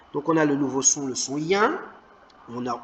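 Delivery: ordinary delivery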